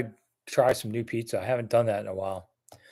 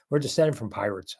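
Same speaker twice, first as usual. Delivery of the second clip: ordinary delivery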